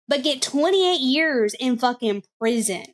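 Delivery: normal talking voice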